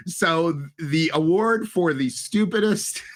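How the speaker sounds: slow, drawn-out award reveal breaking into laughter; mock award announcer. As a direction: announce the winner with high, laughing suspense.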